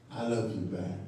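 low, soft reply to an admirer; President Obama. Retold or imitated. President Obama turning around to answer a supporter, low and quiet. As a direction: answer the fan quietly, dropping the pitch. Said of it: "he'd turn around and say".

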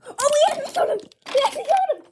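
excited shout. Acted. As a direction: yell it in triumph. Kids cheer a toy battle win.

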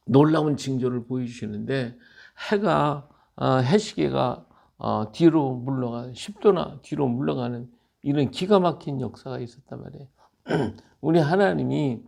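ordinary delivery

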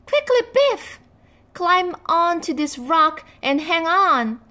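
high, loud urgent cry; urgent shout. A narrator's reading. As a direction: shout over rushing water. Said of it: "shouted Chip".

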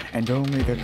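normal talking voice

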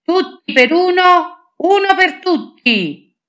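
shouted oath. Acted. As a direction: shout the oath together, swords crossed. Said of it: "gridammo in coro". (giuramento gridato, molto acuto e forte)

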